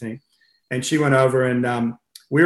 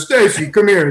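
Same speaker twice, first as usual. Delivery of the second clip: imitated coach, raised and louder call; Russian coach calling; 6 semitones higher, 5 dB louder than usual. Russian coach calling swimmer by the wrong name, imitated loud call. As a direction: call the swimmer over in a high, loud mock voice. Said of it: "she'd come up and he goes".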